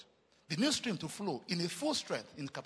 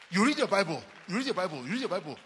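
preaching repetition; 7 dB louder, faster than usual. Acted. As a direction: hammer it then ease off.

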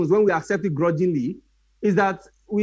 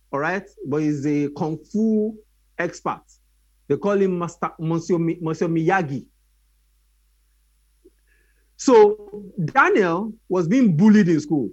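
usual speaking voice

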